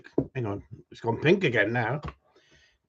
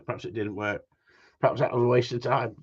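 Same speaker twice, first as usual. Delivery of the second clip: ordinary talk, nothing marked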